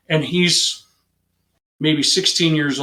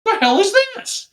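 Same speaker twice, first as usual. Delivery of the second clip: incredulous. Retold or imitated. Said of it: "looked over the car at the other officer like".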